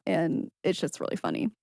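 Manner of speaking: ordinary delivery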